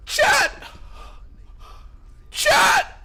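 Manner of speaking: high-pitched yell at chat; excited shriek. Real, not acted. Streamer shrieking to chat as profits spike, ecstatic.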